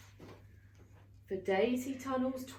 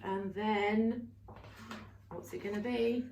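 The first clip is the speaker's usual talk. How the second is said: ordinary delivery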